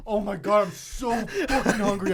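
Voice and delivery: hammed-up hungry whine, laughing; mock whiny. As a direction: whine like a starving kid. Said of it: "he goes".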